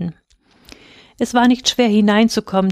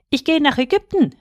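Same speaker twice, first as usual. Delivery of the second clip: high-pitched call, only mildly raised in volume; 7 semitones higher than usual. A narrator's reading. Swallow to anyone below before leaving, calling out. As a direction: lift the pitch as if announcing it from above. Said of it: "rief der Vogel".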